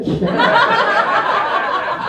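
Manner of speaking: high laugh at the crowd; real amused laugh. Real, not acted. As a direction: burst out laughing. Laughs after crowd reaction.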